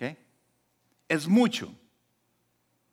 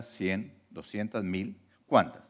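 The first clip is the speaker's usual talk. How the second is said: ordinary talk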